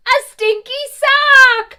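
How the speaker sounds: loud, high, slow disgust. Acted. Mother Goose shows the children a stinky sock, disgusted. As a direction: recoil from the stink.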